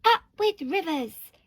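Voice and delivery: high, loud hooted cheer; delighted storybook hoot. A narrator's reading. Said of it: "And they hooted".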